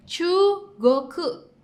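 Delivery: Chugoku drawn out slowly, chu-go-ku; slow model. Shown as a demonstration. Teacher stretching Chugoku in the review drill. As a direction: let them hear each sound of Chugoku.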